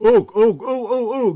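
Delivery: loud high teasing ooh; teasing hoot. Real, not acted. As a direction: hoot like gossip's coming. Teasing hoot about Courtney.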